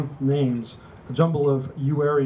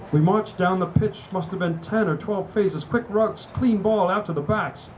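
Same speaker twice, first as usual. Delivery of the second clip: higher, building play-by-play energy; rousing rugby tale, pitched up; excited match account; 6 semitones higher than usual. A narrator's reading. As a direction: relive the winning drive excitedly. Recounting the match's final attack.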